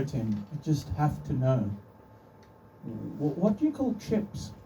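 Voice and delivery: ordinary talk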